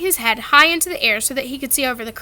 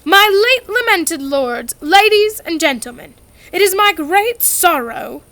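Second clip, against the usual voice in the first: slow, louder solemn address; formal oration; 6 semitones higher, 6 dB louder than usual. A narrator's reading. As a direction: declaim to the hall. Ghost's formal speech.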